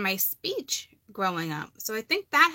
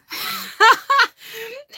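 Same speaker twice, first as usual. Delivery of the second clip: shrieking laugh; helpless squealing laugh; loud high laugh; 9 dB louder than usual. Real, not acted. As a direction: burst out in a high cackle. Laughing at own accent.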